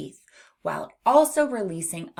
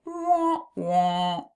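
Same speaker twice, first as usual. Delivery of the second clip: sad trombone noise. Pretend. Teacher marks a no answer, mock sad trombone. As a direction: imitate a deflating trombone, two drawn-out notes. Mimics losing buzzer after No.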